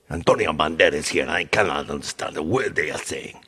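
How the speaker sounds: put-on Spanish accent in English; Spanish-speaking caller. Spanish speaker complaining about the agent, mock Spanish accent. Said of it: "someone who speaks Spanish is like".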